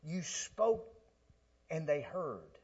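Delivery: soft, earnest retold prayer; a hint of a whispered plea; prayer to the Lord. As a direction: pray it quietly, sincerely. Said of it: "I said".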